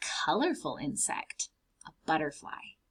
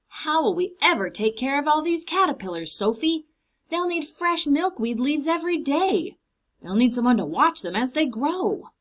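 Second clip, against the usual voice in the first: anxious, higher and louder, rushed; worried old man; 5 semitones higher, 5 dB louder than usual. Acted. Mister McGinty worrying to Sophie, higher and louder. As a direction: fret over too many caterpillars.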